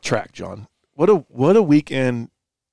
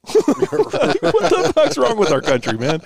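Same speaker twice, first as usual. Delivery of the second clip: laughing disbelief; 12 semitones higher, 5 dB louder, much slower than usual. Real, not acted. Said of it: "laughing incredulous".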